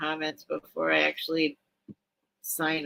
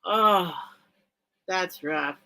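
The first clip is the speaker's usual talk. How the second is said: ordinary delivery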